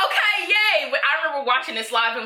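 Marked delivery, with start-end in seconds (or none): 0.1-0.9 high, sarcastic little yay; imitating her own giddy reaction; mock cheer.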